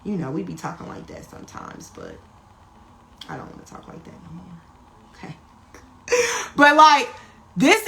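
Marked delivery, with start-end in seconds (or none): 0.0-5.4 sheepish apology. Real, not acted. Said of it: "Let me stop saying it".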